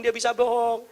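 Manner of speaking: ordinary delivery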